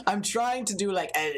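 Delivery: ordinary delivery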